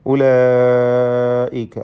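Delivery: ഉലൈക with a long held aa in the middle; obligatory madd. Shown as a demonstration. Teacher shows students the required lengthening, ഉലൈക held. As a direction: stretch the middle vowel of ഉലൈക right out. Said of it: "മദ് മുത്തസിൽ വാജിബ്".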